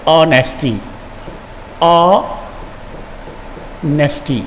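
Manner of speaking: ऑनेस्टी said slowly with no h, starting on a clear o; drawn-out ऑनेस्टी, the h dropped entirely; silent h. Shown as a demonstration. Teacher showing students ऑनेस्टी without the h. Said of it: "इसको ओ से पढ़ते हैं".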